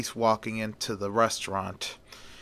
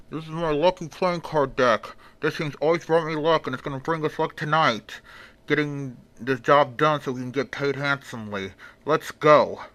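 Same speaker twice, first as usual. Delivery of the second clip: a touch higher, as if talking through a helmet; muffled helmet voice; 4 semitones higher, 3 dB louder than usual. A narrator's reading. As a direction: speak like your mouth is behind a mask. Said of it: "with his muffled voice underneath the helmet".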